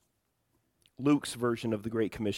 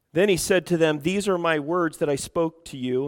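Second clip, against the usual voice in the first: loud, raised-pitch public Bible reading; scripture reading; 7 semitones higher, 11 dB louder than usual. Real, not acted. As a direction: proclaim the verse to the congregation. Reads scripture aloud to congregation.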